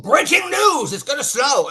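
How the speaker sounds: hammed-up breaking-news alarm, high and loud; mock an overexcited snow bulletin. Mocks panicky weather reporting.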